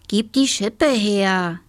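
put-on drawn-out, insistent boy's order; slow, bossy command; demanding. A narrator's reading. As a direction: demand it back, stretching the words. Boy tries to grab the shovel.

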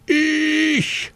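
hoher, gerufener Schrei; Ruf. Acted. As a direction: ruf es ganz hoch heraus. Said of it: "schreie laut und deutlich". (hoher Ruf „Ich!“)

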